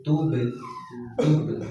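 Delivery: "tub" with an echoing release on the b; bouncing b. Shown as a demonstration. Teacher showing the tajwid rule, "tub" with bounced b. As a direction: bounce the final b of "tub", almost "tu-be". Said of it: "harus memantul".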